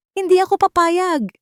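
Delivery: a raging, high scream at full volume; furious shout. A narrator's reading. Consuelo screaming her refusal at Amihan, furious. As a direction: scream your refusal at the whole room. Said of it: "sigaw niya".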